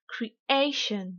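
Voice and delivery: "creation" in English, AY drawn out; teacher's over-stressed "creation", falling after AY; exaggerated English stress. Shown as a demonstration. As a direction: lean hard on AY in "creation", cree-AY-shun. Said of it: "je vais délibérément exagérer".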